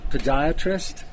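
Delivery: loud outdoor host voice; speaking up over wind on the slope; ski slope. Real, not acted. Presenter intro outdoors, wind.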